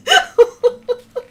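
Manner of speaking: loud, high real laugh; cracking up out loud; high burst of laughter. Real, not acted. Laughing at viewer's spider bite.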